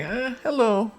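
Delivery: very high, squeaky "eh, hello" like a dazed shopper greeting someone; high-pitched cartoonish hello; mock casual shopper. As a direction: play a clueless guy strolling the aisle, chirping hello. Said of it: "He's like".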